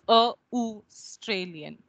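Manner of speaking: "a Australian" split as uh, oo, stralian; broken-down pronunciation. Shown as a demonstration. Teacher spells out the sounds, segmented AUSTRALIAN. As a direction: take AUSTRALIAN apart, uh then oo then the rest. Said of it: "That is how it is written".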